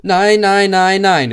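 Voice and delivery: high, loud defiant shouting; cheeky freight cars shouting; trucks shouting. A narrator's reading. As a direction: yell no like unruly wagons. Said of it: "riefen die Güterwagen".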